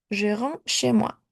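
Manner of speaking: usual speaking voice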